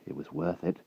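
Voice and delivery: soft hushed farewell; boy quietly accepting his fate; whispered. A narrator's reading. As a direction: say it under your breath. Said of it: "whispered the wart".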